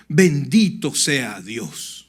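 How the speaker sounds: slow, drawn-out, awed "Bendito sea Dios"; slow awed blessing. Retold or imitated. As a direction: let each word land in wonder, slowly. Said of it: "se puso las dos manos … y dijo".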